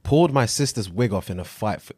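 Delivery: ordinary talk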